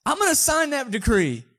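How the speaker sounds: loud, high mock-drunk outburst; drunk king. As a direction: announce it like a tipsy ruler.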